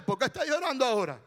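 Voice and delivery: fast, fed-up question, full voice; mock impatient scolding. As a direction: demand it briskly, as if annoyed by the crying. Acts impatient husband, counterexample.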